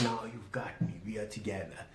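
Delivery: gently hushed reassurance; soft hush. Shown as a demonstration. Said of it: "take it down a notch".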